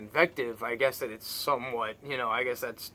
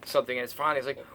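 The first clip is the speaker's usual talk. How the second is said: usual speaking voice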